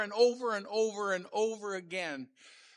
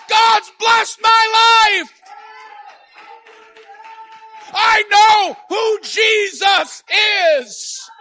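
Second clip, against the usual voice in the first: high, loud, fervent testimony; preaching at full force; 12 semitones higher, 16 dB louder, slower than usual. Real, not acted. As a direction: testify with the whole chest.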